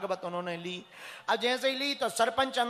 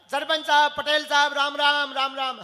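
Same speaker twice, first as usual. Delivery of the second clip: loud greeting; 5 semitones higher, 8 dB louder than usual. Retold or imitated. Pandit loudly greets the headman.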